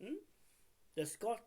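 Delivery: ordinary talk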